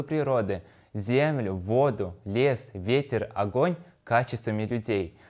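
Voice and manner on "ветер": usual speaking voice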